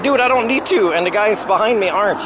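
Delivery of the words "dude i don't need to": high, loud exasperated comeback; exasperated retort. Retold or imitated. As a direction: brush off the pushy driver. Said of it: "It's like".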